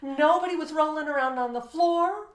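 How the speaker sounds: amazed, emphatic. Retold or imitated. Amazed list of good behaviour.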